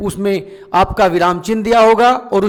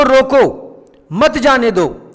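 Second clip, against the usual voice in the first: firm रोको, a break, then मत जाने दो; pause after रोको; 3 semitones higher, slower than usual. Shown as a demonstration. Teacher explains the warning meaning, pausing after रोको. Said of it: "जो हमने विराम चिन्ह कहाँ लगा?".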